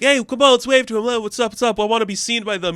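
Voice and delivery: high-pitched, eager calling out; giddy fan waving someone down; excited kid. Retold or imitated. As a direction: shout up to your hero, thrilled to be noticed. Kids excitedly hailing the big guy.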